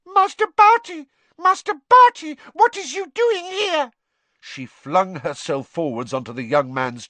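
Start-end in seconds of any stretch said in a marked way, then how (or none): 0.1-3.9 very high, loud house-elf shriek; a frantic elf shrieking at her master; piercing shriek. A narrator's reading. Frantic house-elf shrieks on finding her young master. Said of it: "she let out a piercing shriek".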